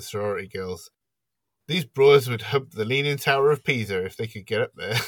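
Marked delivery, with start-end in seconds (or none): 1.7-5.0 higher, louder mock Mrs Mack delivery; lightly acted film quote, raised and loud; quoted movie line. Host quoting Mrs Mack on the sorority girls, lightly acted.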